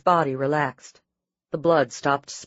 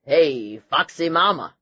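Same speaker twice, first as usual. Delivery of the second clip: playful yell; 3 dB louder, slower than usual. A narrator's reading. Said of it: "he yelled at her".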